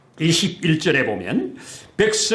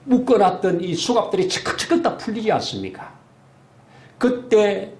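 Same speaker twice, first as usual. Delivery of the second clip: ordinary talk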